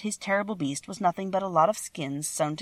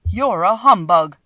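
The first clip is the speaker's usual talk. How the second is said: loud, slow accusation; grieved tone; 2 semitones higher, 10 dB louder, slower than usual. A narrator's reading. Scarecrow accusing the false wizard, in a grieved tone. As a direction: land each word heavily, aggrieved. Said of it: "in a grieved tone".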